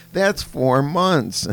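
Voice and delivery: mock child voice working out the sum; hammed-up kid counting on fingers; imitating a little girl. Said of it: "it shows her counting on her fingers".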